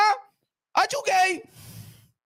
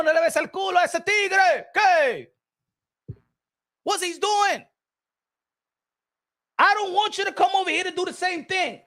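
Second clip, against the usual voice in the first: ordinary talk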